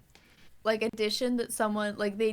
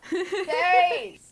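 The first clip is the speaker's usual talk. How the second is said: loud call to another room; yelling across the house; 8 semitones higher, 10 dB louder than usual. Real, not acted. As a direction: shout it out through the house. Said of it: "you can just yell across the house".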